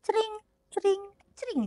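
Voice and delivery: high, soft tinkling "cring"; dainty sprinkling sound. Retold or imitated. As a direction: imitate delicate splashes, one by one. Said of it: "dengan indahnya dia cipratin".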